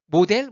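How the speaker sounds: 모델 loud, "mo-del", with a full D; Korean-style loanword. Shown as a demonstration. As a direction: say 모델 as written in Korean. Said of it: "못 알아들어요".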